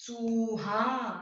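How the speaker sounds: चूहा very slow, the ऊ held long; stretched long ऊ. Shown as a demonstration. Teacher showing students the long vowel, slow stretched word. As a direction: draw out the ऊ in चूहा. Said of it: "जोर लगता है ना".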